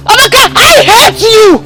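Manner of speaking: slow, high anguished cry; anguished hate. Acted. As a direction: drag out the hatred in pain. Tearful hatred at sister.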